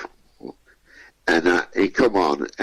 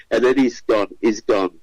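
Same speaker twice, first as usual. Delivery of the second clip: ordinary talk, nothing marked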